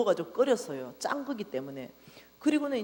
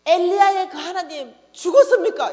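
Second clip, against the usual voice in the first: high, loud furious yell at God; screaming accusation to heaven; angry shout; 9 semitones higher, 12 dB louder than usual. Retold or imitated. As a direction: rail at God in outrage. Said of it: "내가 난리칠 때 이래 쳤거든요".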